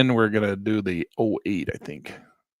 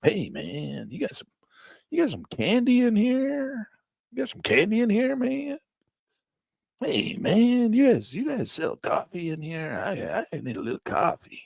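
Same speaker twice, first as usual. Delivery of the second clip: high, slow spaced-out hippie; stoner customer; 9 semitones higher, slower than usual. Retold or imitated. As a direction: ask for candy like a stoned customer. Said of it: "Guys come".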